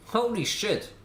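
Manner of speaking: high excited outburst; surprised exclamation. Real, not acted. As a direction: blurt it out amazed. Sees render.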